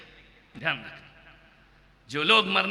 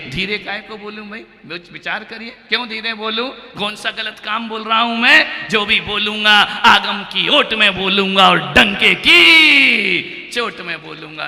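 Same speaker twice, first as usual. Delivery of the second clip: ordinary delivery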